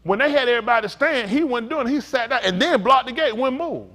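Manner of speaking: loud, high-pitched preaching; full-force preacher delivery; forceful preaching. Real, not acted. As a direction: hammer out the story to the congregation.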